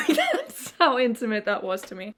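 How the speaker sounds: real laugh breaking into the words. Real, not acted. Joking, laughs at own remark.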